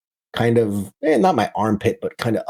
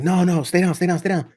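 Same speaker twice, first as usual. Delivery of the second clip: urgent; 8 semitones higher than usual. Retold or imitated. Medic urges him to stay down.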